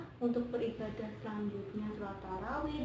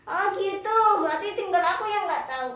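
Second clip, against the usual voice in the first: high, loud puppet realisation; Momon; 6 semitones higher, 10 dB louder, faster than usual. Pretend. Momon admits not knowing the prayer, high loud puppet voice. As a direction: exclaim in a piping puppet voice.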